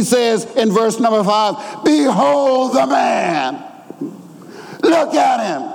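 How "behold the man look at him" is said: high, drawn-out cry of presentation; emphatic proclamation. Acted. Pilate presenting Jesus to the people, emphatic proclamation. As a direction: proclaim it slowly and high to the crowd. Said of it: "He's using an emphatic voice here".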